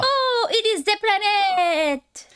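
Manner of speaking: high, slow hammed-up voice with a laugh; silly voice. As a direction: stretch it out in a goofy high voice. Playful put-on voice.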